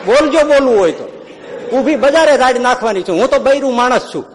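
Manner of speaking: high, loud laughing dare; daring woman's challenge. Acted. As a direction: throw down the challenge loudly. Woman dares Maharaj to shout. (ઊંચા મોટા અવાજે પડકાર)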